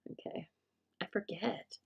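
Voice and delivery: soft whispered aside; mumble-whisper to herself. Real, not acted. Aside while stamping.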